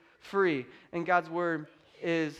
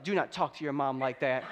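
daughter copying dad; 6 semitones lower, 3 dB quieter than usual. Retold or imitated. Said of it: "She's like".

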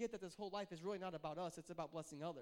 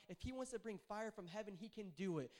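ordinary talk

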